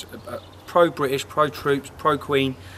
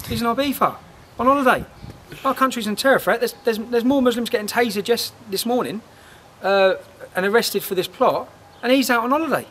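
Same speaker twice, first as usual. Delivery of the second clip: higher, exasperated outrage; rising disbelief at the PM; indignant rant; 7 semitones higher than usual. Real, not acted. Ranting about PM on holiday.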